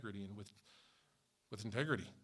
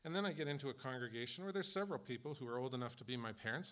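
normal talking voice

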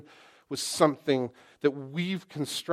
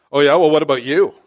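louder, fast, snappy comeback; defensive retort; 13 dB louder, faster than usual. Retold or imitated. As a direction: throw it back in their face. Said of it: "you know what you get?".

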